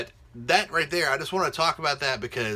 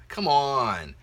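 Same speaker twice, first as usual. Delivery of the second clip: ordinary talk, nothing marked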